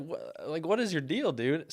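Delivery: high, incredulous what-is-your-problem; exasperated. Retold or imitated. Asking the rude neighbor what his problem is, high exasperated disbelief.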